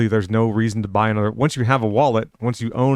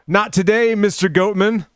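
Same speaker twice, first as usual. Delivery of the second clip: lightly put-on, much higher voice; mock quoted voice; 9 semitones higher than usual. Joking aside in the middle of a school story, high mock voice. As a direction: toss it out as a high-pitched joke quote.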